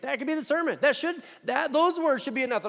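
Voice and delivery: high, loud preacher's cry; fervent preaching. Real, not acted. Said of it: "preaching at full force".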